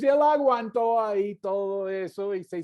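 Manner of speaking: ordinary delivery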